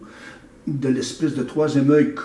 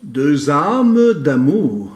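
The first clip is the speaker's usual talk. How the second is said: very slow, loud declaimed title; title proclaimed as verse; poem recitation; 6 dB louder, much slower than usual. Acted. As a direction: draw out ÂMES, then let it fall. Echoes the poem's title.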